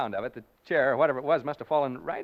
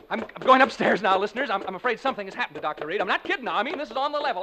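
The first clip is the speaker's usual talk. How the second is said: higher, louder, hurried; urgent and scared; 6 semitones higher, 5 dB louder, faster than usual. Acted. Announcer telling listeners he fears for the doctor. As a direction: rush the words as fear builds. Rushing upstairs in alarm.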